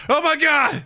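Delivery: high, shocked exclamation; shocked soldier. Retold or imitated. Guard arriving on the scene, high shock. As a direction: gasp it out high. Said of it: "He's like".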